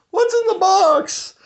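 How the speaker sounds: hammed-up shrieking despair. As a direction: push the plea up into a frantic squeal. Repeat, pushed even further.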